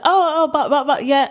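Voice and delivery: a touch of mum's hurried interruption; imitating mum interrupting. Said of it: "Mum will just cut it out and just be like".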